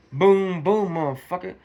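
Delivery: loud punchy boom boom gunshot noises; imitated gunshots. Telling how the shooter gunned them down, loud booming gunshot sounds. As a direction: blast it out like a shotgun firing twice. Mimics shotgun blasts in story.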